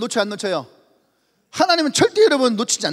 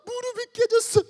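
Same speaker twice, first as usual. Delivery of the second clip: put-on much higher child voice; whiny child; 11 semitones higher than usual. Child hesitating to tell mother, high and whiny. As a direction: play a whimpering kid.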